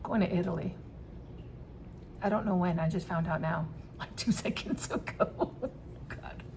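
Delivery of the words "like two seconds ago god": soft breathy laugh through words; quiet amazed laughter; laughing softly. Real, not acted. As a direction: giggle out the words in disbelief. Laughing at own realization.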